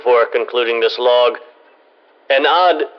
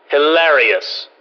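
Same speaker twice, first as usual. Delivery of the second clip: slow, dry, deadpan sarcasm; drawn out with withering disdain; slower than usual. Acted. Scientist mocking his colleagues' pranks, slow and sarcastic.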